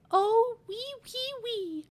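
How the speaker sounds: high squeaky piglet squeal; excited little pig. Acted. Piglet squeals his little song.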